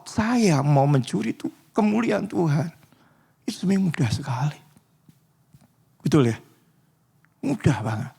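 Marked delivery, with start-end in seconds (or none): 3.4-8.1 fast hushed whisper; quick conspiratorial whisper; confiding whisper. Real, not acted. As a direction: let them in on a secret. Confiding aside about stealing glory.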